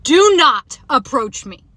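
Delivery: slow, loud, forceful warning; retold yell. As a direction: yell it back, each word drawn out. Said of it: "I literally yelled at him".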